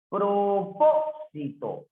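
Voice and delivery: "Propósito" drawn out, PÓ held; slow "Propósito" down and lean on PÓ; esdrújula stress. Shown as a demonstration. Teacher showing an esdrújula, "Propósito" slow with stressed PÓ. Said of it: "es esdrújula".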